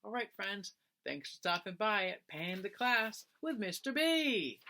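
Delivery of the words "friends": usual speaking voice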